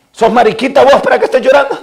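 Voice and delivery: usual speaking voice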